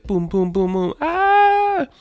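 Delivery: quick booms into a high squeal; cartoon minion squeal; minion noises. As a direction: babble booms, then a long, very high "ah". Imitates a minion's noises, ending in squeal.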